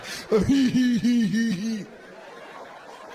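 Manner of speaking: a big burst of laughter; loud real laugh. Real, not acted. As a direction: laugh out loud at your own joke. Comic laughs at own joke.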